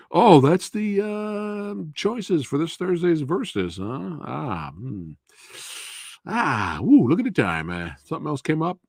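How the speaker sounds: high, airy fake-busy excuse voice; hammed-up high excuse voice; mock excuse-making viewer. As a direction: play a flaky friend dodging the invite. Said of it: "everyone would be like".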